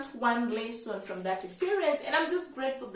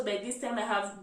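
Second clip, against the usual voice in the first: ordinary talk, nothing marked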